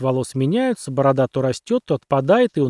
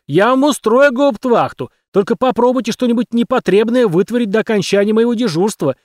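loud, higher angry tirade; angry yelling; 6 semitones higher, 5 dB louder than usual. A narrator's reading. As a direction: keep roaring at them. Captain keeps yelling at cadets.

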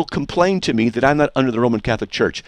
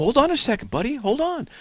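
insistent, pitched-up cut-in; interrupting; 12 semitones higher, 3 dB quieter than usual. Real, not acted. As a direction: stop the other debater in his tracks. Halting opponent mid-answer.

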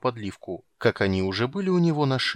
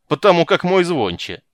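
high loud mocking shout; 7 semitones higher, 10 dB louder than usual. A narrator's reading. Guards jeer at Geralt.

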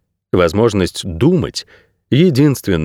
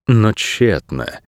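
ordinary delivery, nothing marked

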